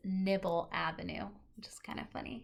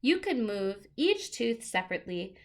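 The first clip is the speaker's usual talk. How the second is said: lively picture-book read-aloud; reading to children; 11 dB louder than usual. Acted. Teacher reading a book to the class, lively and clear.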